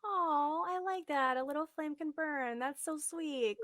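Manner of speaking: soft, high cooing; gentle, high-pitched appreciation; touched, tender. Real, not acted. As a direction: coo it softly, as if moved by something sweet. Host touched by guest's motto.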